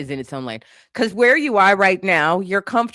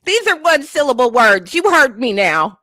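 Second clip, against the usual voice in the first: laughing mockery; 9 semitones higher, 7 dB louder than usual. Real, not acted. Mocking the lyrics, laughing.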